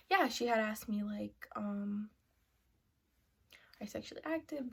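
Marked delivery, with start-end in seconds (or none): 3.8-4.7 softly whispered, a touch higher; hushed question. Retold or imitated. Retelling the nurse's question in a quick hush.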